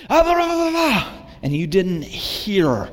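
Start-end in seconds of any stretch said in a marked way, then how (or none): 0.1-1.1 mock someone talking over others; mocking chatter. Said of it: "just go".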